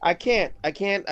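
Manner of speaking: ordinary delivery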